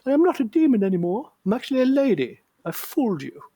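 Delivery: put-on high falsetto of a demon posing as a lady; high-pitched possessed voice. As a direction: squeak it out high, mimicking a possessed man. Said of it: "I don't know, high-pitched".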